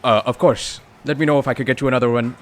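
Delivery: a salaryman smoothing over a blunder; overly polite offer. Acted. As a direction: gush out the offer quickly. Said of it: "keeps up polite act".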